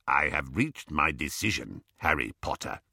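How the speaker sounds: low, measured goblin voice; solemn goblin. Acted. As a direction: pronounce it as a grave goblin, pitched low. Goblin announces his decision.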